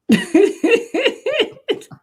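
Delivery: big high burst of real laughter at his own punchline; loud, high, delighted real laugh; hearty laugh. Real, not acted.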